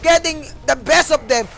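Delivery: high, very loud shoutcast at full force; excited play-by-play. Real, not acted. Caster calling a team fight to viewers, excited shouting.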